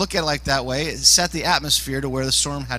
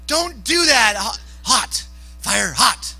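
put-on high, urgent warning to a child; hammed-up parent scolding a son; alarmed parent; 5 semitones higher, much slower than usual. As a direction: call out a warning, then short bursts. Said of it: "Be like".

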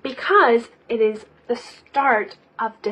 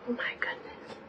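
breathless whispered awe; whispering; 17 dB quieter than usual. Real, not acted. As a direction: whisper it in wonder.

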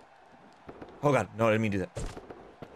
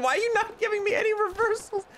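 whiny near-crying complaint; raging gamer; 10 semitones higher, faster than usual. Real, not acted. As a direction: whine like you're about to cry. Game won't give reversals.

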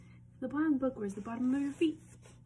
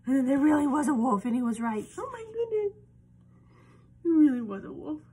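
excited; 4 semitones higher, 8 dB louder, faster than usual. Acted. Excited climax retelling.

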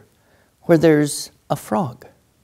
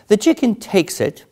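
ordinary delivery, nothing marked

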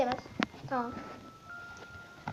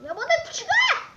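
high, slow, drawn-out yell at the fish; frustrated shout; 11 semitones higher, 6 dB louder, slower than usual. Real, not acted. Gamer yelling at piranhas, frustrated high shout. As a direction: yell it out, fed up.

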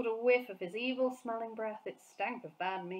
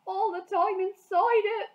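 much higher and louder, aghast; distressed cry; 11 semitones higher, 9 dB louder than usual. A narrator's reading. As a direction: burst out in horror. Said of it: "the B F G cried".

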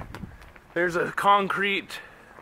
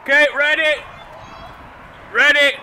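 loud, high call; 6 semitones higher, 7 dB louder than usual. Real, not acted. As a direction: call out across the yard. Calling out to the kids.

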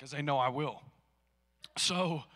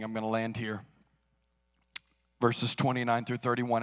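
ordinary delivery

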